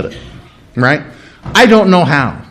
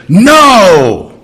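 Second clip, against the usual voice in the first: long, swooping parental NO; totally frustrated no; 2 semitones higher, 4 dB louder than usual. Acted. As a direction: cut the child off with an exasperated, drawn-out no. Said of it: "your parents were totally frustrated and they said".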